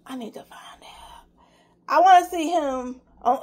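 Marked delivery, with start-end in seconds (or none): 0.1-1.2 breathy whisper to himself. Real, not acted. Vlogger whispering his wish to see the actor again. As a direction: let it drop into a secret whisper.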